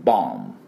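ボム dropping low, ending on a hummed M; silent final B. Shown as a demonstration. Teacher shows that bomb's B is silent, silent final B. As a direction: say ボム with lips closed at the end, no B. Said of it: "Bは発音しません。".

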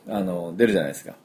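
ordinary talk

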